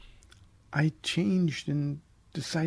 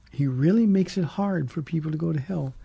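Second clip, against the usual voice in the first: normal talking voice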